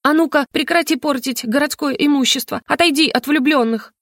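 high, bold but put-on shout; fake-brave shout. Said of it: "громко, с напускной храбростью, выкрикнула".

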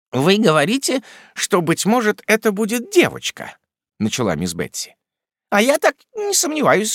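high, self-assured stern aunt; certain old aunt. A narrator's reading. Miss Betsey insisting on a girl, self-assured. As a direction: declare it as settled.

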